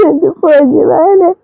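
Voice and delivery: slow, tender, tearful call to a son; tearful longing. Acted. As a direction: ache for the lost son. Tender cry to her son. (കണ്ണീരോടെ, പതുക്കെ)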